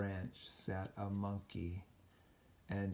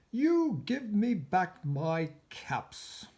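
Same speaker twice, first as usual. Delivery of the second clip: high, slow, louder scolding; scolding peddler; 9 semitones higher, 8 dB louder, slower than usual. Acted. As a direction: demand the caps back, finger raised. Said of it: "he said, shaking a finger".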